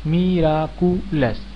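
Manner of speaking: मिराकुलस very slowly as मि, रा, कु, लस; four-syllable split. Shown as a demonstration. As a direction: stretch मिराकुलस into four separate beats. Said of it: "उच्चारण चार खंडों में होता है".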